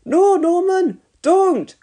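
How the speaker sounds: crying out. A narrator's reading. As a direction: stop him before he presses it. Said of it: "cried Dilys".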